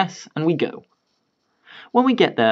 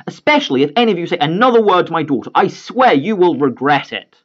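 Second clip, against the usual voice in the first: loud, higher furious father; angry threat; 6 semitones higher, 4 dB louder than usual. Retold or imitated. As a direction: warn him inches from his face.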